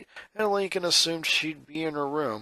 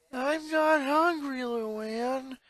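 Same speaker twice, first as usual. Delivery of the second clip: moaned; 9 semitones higher, much slower than usual. Acted.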